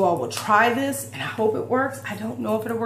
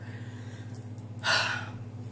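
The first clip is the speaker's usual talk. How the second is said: heavy sigh; 11 dB quieter than usual. Real, not acted. After telling emotional story.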